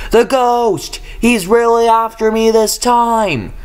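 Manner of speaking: high, strained voice of pure panic; panicked cry. A narrator's reading. Engine racing through the sidings in panic. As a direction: cry out as the ghost closes in.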